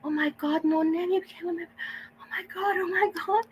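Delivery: high-pitched shrieks of joy; shrieking jackpot winner. Real, not acted. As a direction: shriek like you just won big. Excited reaction to a donation.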